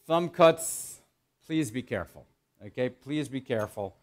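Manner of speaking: ordinary talk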